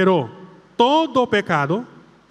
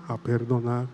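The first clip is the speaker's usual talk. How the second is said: lower, softer a perdonar; hushed echo; 10 semitones lower, 8 dB quieter than usual. Shown as a demonstration. Priest models the quiet response to the prayer. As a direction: murmur the answer. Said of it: "Otra vez, vamos".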